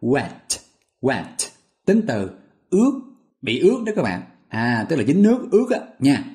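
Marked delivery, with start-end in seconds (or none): 0.0-1.2 "wet" said slowly, clearly, like "quét"; teacher modelling "wet". Shown as a demonstration. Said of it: "sẽ nhớ từ vựng là wet". 1.9-4.2 "ướt" pitched high with a rising lift; teacher highlighting "ướt"; stress on ướt. Shown as a demonstration.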